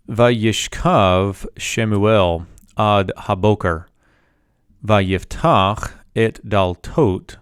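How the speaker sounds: slow, deliberate recitation of the Hebrew verse; slow Hebrew reading. Shown as a demonstration. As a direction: read each Hebrew phrase slowly with long pauses. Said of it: "Let's read this together".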